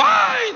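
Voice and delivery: high-pitched startled "¡ay!" yelp; sudden high cry, replayed for laughs; retold yelp. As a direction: act out a yelp under cold water. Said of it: "yo también hago".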